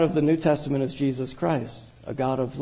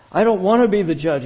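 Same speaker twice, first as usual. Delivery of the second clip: louder, higher protest; 4 semitones higher, 6 dB louder than usual. A narrator's reading. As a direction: push back, raising the voice. Cornered father protests in story.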